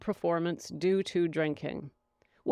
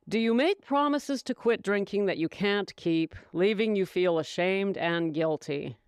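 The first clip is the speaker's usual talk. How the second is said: normal talking voice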